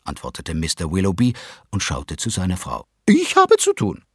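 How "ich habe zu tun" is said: much higher, dismissive wife; Mrs Willoughby's voice. A narrator's reading. Mother refuses to read, high and dismissive. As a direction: brush them off from on high. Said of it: "sagte Misses Willoughby".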